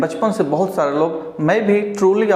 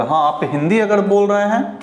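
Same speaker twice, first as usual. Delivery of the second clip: usual speaking voice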